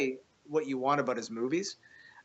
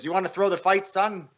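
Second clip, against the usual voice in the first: gruff ref; 5 semitones higher, 4 dB louder than usual. Retold or imitated. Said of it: "the ref comes up to me and goes".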